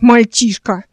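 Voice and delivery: loud, angry rebuke; angry outburst. A narrator's reading. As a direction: scold loudly in a flash of anger. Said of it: "Эл разозлилась". (сердитый громкий возглас)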